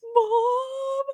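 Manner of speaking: put-on high loud child wail; child crying mom. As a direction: cry out like a scared kid. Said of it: "he's like".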